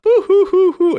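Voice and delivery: high, loud boo-hooing; hammed-up crying in imitation; mock crying. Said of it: "being all like".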